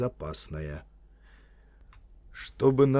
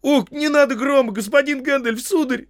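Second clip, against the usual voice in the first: very high, loud begging; squealed appeal for mercy; panicked pleading; 10 dB louder than usual. Acted. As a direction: plead like a frightened servant caught out. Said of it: "взмолился Сэм".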